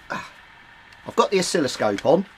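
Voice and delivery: normal talking voice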